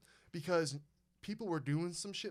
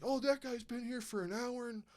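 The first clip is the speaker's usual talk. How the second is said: high, bright old lady voice imitated; lightly voiced old lady chiming in; 7 semitones higher than usual. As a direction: mimic a fussy old lady, pitched up. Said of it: "other old lady's like".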